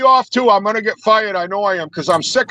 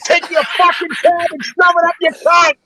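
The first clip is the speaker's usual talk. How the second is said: road rage insult; 4 semitones higher than usual. Real, not acted. Road rage at cab driver.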